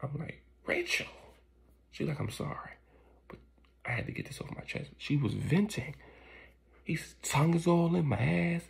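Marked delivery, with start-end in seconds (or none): 0.7-1.1 name yelped up high in shock; shocked cry. Retold or imitated. Wife startled mid-sex, crying out his name. 2.2-4.9 hushed, breathy apology; whispered confession. Retold or imitated. Wife confessing to husband in a guilty whisper.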